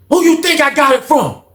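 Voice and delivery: high-pitched comeback; indignant retort. Retold or imitated. Skate answering Rob's accusation, indignant retort. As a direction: throw the question back, heated.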